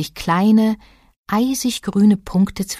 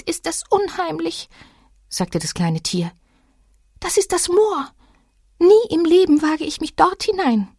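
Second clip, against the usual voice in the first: much higher, uneasy animal voice; scared little creature; 11 semitones higher than usual. A narrator's reading. Little animal refusing to enter the moor, high and uneasy. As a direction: shrink back from the moor.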